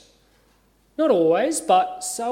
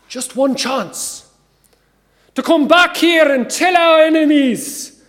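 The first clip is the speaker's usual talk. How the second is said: put-on slow Scottish-accented oration building to a huge shout; William Wallace; 4 semitones higher, 8 dB louder, slower than usual. Commander urging his army to fight for freedom, building Scottish-accented shout. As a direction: build from rousing appeal to a drawn-out, high battle roar in Scottish accent. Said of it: "my attempt at a Scottish accent".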